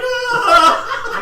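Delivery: shrill mock scream, high and loud. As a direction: scream in pretend terror. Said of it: "you're like".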